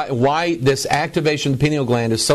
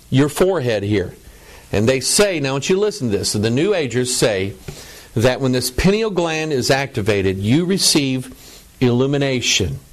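ordinary delivery

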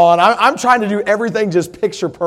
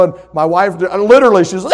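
normal talking voice

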